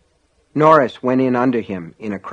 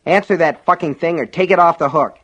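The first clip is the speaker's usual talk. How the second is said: higher, irritated snap; snapped; 7 semitones higher, 4 dB louder than usual. A narrator's reading. Alan ordering Norris to stop the ringing phones, snapping. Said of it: "Alan snapped at Norris".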